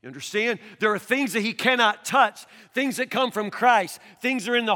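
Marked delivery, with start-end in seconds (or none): none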